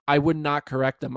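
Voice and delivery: ordinary delivery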